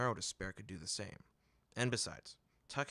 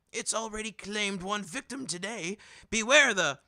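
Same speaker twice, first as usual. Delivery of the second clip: much higher, building to a loud cry; dramatic huckster warning; 11 semitones higher, 10 dB louder than usual. Acted. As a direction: spook the customer with mock menace.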